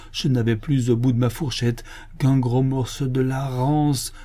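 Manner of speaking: usual speaking voice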